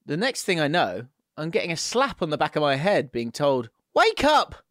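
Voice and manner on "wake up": sudden high, loud exclamation; shouted wake-up. A narrator's reading. Missus slapping a dozing partner, shouted wake-up call. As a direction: cry out to rouse a sleeper. Angry missus slaps sleeping man, quoted.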